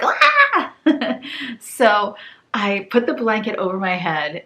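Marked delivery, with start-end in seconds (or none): 0.0-0.7 very high laughing squeal; Kim's squeal. Retold or imitated. Imitating Kim from the Wads, a high laughing squeal. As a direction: squeal the way she always does. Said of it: "She's always like".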